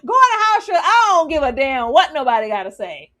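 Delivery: high, loud scolding call to Shirley; playful shout. Retold or imitated. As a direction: yell her back inside, half joking. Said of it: "I said".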